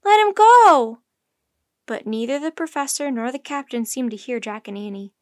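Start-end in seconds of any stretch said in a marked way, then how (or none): 0.0-1.0 very loud, high plea; desperate full-voiced cry; screaming. A narrator's reading. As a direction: scream for his release. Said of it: "screamed Annie".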